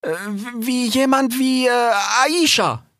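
high, slow and faltering; hesitant. A narrator's reading. Rix hesitantly suggests Aisha as ruler, high and halting. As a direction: grope for the name with stretched pauses. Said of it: "sagte Rix zögernd".

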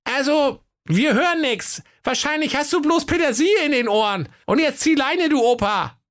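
very high, squeaky bratty voice; high-pitched insolent goatlings; dismissive little goats. A narrator's reading. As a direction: sass the old cop like cheeky kids. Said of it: "die Geißlein ... sagten".

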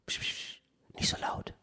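breathy whisper, hushing someone; whispered shush. Acted. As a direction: shush them in a whisper like a bodyguard. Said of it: "der gesagt hat". (flüsternd beschwichtigen)

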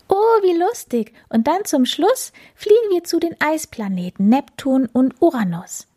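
hohe, lockende Katzenstimme; fröhlich und hoch locken; Plapperkatze. Acted. Katze freut sich. (verspielte hohe Katzenstimme)

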